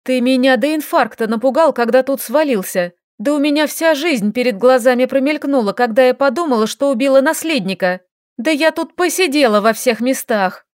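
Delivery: loud, high-pitched upset rant; upset scolding. Acted. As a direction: vent the fright at him. Lilka scolding after fright.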